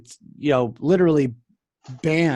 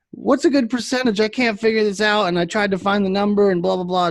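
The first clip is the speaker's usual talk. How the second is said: high, fast, whiny imitation of the caller; mock high fast babble of a lost customer; mocking a clueless customer; 6 semitones higher than usual. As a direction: ham up a confused customer rattling on. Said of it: "And he's like".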